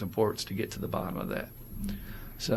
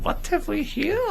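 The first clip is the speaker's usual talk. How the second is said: much higher put-on officer voice; mock Nazi officer. Friend mocking the brag by voicing the Nazi officer in a high put-on voice. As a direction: sneer it like a cartoon Nazi officer. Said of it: "The Nazi would have looked at you and said".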